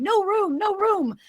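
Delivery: high-pitched cry without the volume; mock screaming. March Hare and Dormouse joining in, small mock scream. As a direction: squeal it high, held back in level. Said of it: "the March Hare and the Dormouse start screaming".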